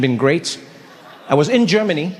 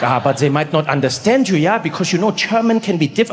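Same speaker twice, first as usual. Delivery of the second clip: put-on doubtful German-accented friend. Friend warning he might not be understood, German accent. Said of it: "He's like".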